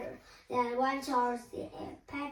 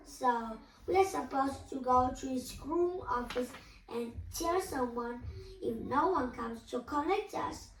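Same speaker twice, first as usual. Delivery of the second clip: usual speaking voice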